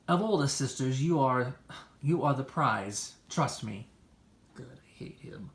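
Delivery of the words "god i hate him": muttered aside. Acted. Muttered aside after compliment.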